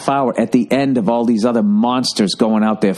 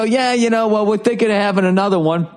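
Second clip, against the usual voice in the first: high chipper reply; 7 semitones higher than usual. Retold or imitated. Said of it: "And then I go".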